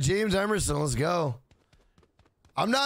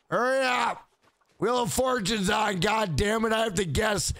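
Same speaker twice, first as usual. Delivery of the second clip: raised, hammed-up irritated operator voice; mock nagging, pitched up and impatient; impatient sex operator; 4 semitones higher than usual. Acted impatient operator missing her show.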